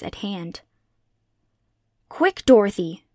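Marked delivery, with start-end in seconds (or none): 2.1-3.0 put-on loud, urgent cry to a child; screamed warning. A narrator's reading. Aunt Em calls Dorothy as the cyclone nears, screaming urgently. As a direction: shout it sharply in alarm. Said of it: "she screamed".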